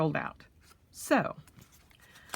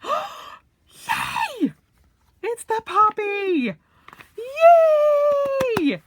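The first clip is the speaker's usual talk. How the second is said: excited squeal; 9 dB louder, much slower than usual. Real, not acted. Finds the wanted poppy color.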